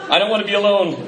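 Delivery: mock pleading. Speaker jokingly begs audience to join him, mock pleading. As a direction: plead as if desperate for company.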